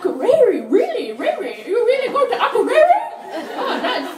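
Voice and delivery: high, loud, sarcastic disbelief; mock impressed. Comic mocking a tourist's trip plan, high sarcastic wonder. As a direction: fake amazement, rising. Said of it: "I was like".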